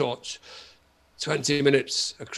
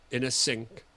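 normal talking voice

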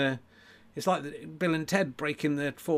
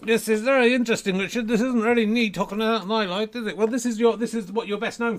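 high-pitched puppet interrupting; puppet sidekick; 9 semitones higher, 5 dB louder than usual. Pretend. Puppet needles Richard about his best-known sketch, high puppet voice.

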